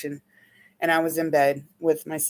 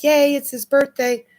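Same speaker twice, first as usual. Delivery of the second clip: mock cheerful; 8 semitones higher, 6 dB louder than usual. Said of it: "She kinda like".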